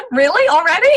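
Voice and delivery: amazed laugh. Retold or imitated. Amazed it happened so soon.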